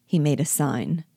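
ordinary delivery, nothing marked

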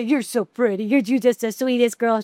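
cooing sweet voice; sweet baby talk to the dog. Retold or imitated. Coos sweetly to her dog. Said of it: "We actually say".

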